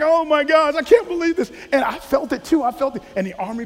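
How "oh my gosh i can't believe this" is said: higher, louder mock disbelief. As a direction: exclaim it, half-laughing at the mix-up. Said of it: "I'm like".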